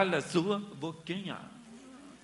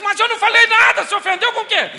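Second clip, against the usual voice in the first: mock shouted retort; 17 dB louder than usual. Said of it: "Você diz".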